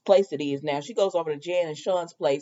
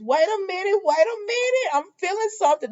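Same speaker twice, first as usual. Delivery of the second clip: very high-pitched imitated interruption; mock psychic alarm; 13 semitones higher, faster than usual. Susan suddenly sensing something among them, mock alarm. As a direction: halt everyone in a high hammed-up voice.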